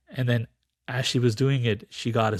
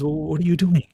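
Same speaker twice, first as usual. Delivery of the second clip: lightly voiced father bird, higher and louder; a hint of a puzzled bird questioning; 5 semitones higher, 5 dB louder than usual. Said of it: "The father bird comes and says".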